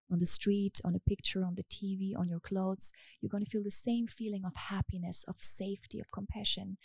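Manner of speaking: steady hypnotic guidance; hypnosis script. Acted. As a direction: lull her gently into calm. Hypnosis session guiding listener.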